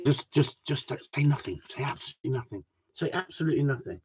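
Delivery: hushed retold advice; hushed warning. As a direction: quietly urge someone to keep silent. Acts out Sue's warning to him.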